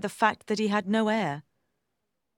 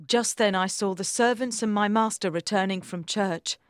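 usual speaking voice

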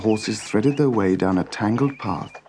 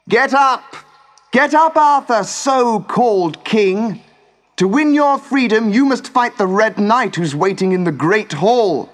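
much higher, louder mocking shout; scornful shout; 10 semitones higher, 6 dB louder than usual. Morgan Le Fay shouts at chained Arthur, loud and scornful. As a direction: jeer at the captive king through the door. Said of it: "A woman's voice shouted".

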